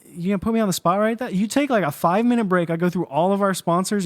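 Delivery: mock outrage at being put on the spot; indignant surprise. Real, not acted.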